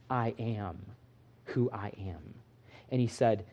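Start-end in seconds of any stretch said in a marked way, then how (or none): 0.1-2.3 very soft, low and hushed; solemn divine voice. Retold or imitated. God naming himself to Moses, hushed and low. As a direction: speak it like a sacred whisper. God's name spoken.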